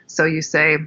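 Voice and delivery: ordinary delivery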